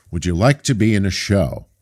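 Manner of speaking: usual speaking voice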